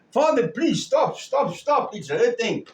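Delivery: put-on high, pleading child's cry to stop. Child pleading with father in story.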